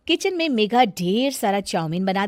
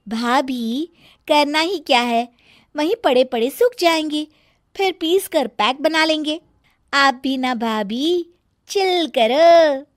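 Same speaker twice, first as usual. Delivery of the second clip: carefree Sonam; 6 semitones higher, 4 dB louder than usual. Acted. Sonam shrugging off her sister-in-law, lightly raised casual voice. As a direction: brush her off lazily.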